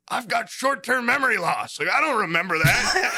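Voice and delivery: mock drunk guy, giggling then yelling; imitating the bearded guy. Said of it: "He's like".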